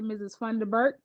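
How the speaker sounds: usual speaking voice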